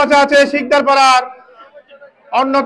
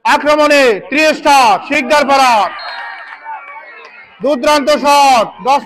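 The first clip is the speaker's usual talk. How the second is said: fast excited match commentary; frantic football commentary. Real, not acted. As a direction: call the action live, urgently. Live play-by-play commentary.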